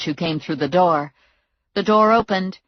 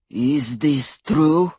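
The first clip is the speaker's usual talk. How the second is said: slow thug voice; 2 semitones lower, much slower than usual. A narrator's reading. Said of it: "Blutovich looked over at Eugene and Mo".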